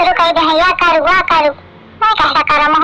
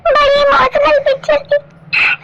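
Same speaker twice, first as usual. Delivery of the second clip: high, slow sobbing wail; milk the fake tears, pitched up; hammed-up crying; 6 semitones higher than usual. Bhauji tells Kallu the film was emotional, high mock sobbing. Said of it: "मुझे रोना ही आ रहा है".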